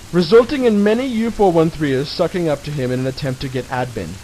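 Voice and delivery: ordinary talk, nothing marked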